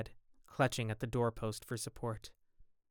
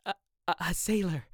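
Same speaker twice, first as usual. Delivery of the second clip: cried out in shock; 6 semitones higher than usual. A narrator's reading. Dorian cries out to the gamekeeper in shock. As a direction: gasp it out, stunned. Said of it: "he cried out".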